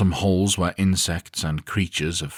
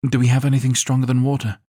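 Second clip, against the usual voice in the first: higher, louder, fast splutter; spluttering woman; 9 semitones higher, 7 dB louder, faster than usual. A narrator's reading. Sick woman begging for a drink, spluttering fast. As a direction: gasp it out between retches. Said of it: "Zarina splutters".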